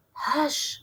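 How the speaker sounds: ordinary delivery, nothing marked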